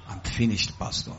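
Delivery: very quiet, low plea for sympathy; mock despair. Church member whipping up sentiment to the pastor, mock despair. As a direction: feign defeat under your breath. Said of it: "we want to whip up sentiments".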